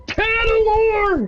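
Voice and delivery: a high, drawn-out, hammed-up death scream; dying scream. Quoting a stabbed hero's last cry, high slow mock scream. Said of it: "got stabbed and screamed".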